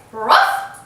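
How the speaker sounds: loud bark; dog bark. Shown as a demonstration. Showing viewers how to bark for the dog, loud bark. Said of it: "You say".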